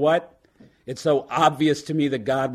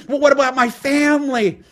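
put-on much higher, louder panicked drunk; backpedaling drunk; 12 semitones higher, 7 dB louder than usual. Drunk trying to back out of the deal, high and alarmed. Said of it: "He said".

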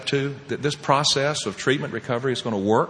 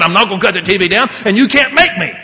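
raised, higher defiant retort; defiant son; 7 semitones higher, 6 dB louder, faster than usual. Retold or imitated. Son refuses his father to his face, acted defiant and loud. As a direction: imitate a son squaring up to his dad.

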